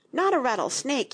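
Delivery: mildly anxious clarification; hasty reassurance. A narrator's reading. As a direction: add it as a hurried reassurance. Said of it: "she added hastily".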